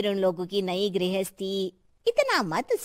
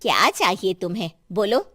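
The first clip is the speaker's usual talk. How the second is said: ordinary delivery, nothing marked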